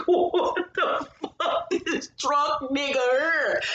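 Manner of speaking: high, slow, laughing what the fuck; cracking up at the drunk husband; laughing disbelief. Retold or imitated. Watching the drunk husband's declaration, slow high laughing disbelief. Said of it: "I was like".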